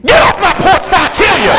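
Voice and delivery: sudden high-pitched threatening yell; mock furious shout. Mom interrupts her prayer at a porch intruder, shouting threat. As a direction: burst out of prayer into a gangster threat.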